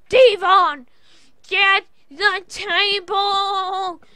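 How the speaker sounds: drawn-out gleeful holler; fan's shout. Real, not acted. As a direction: yell it like you're in the crowd. His own fan outburst.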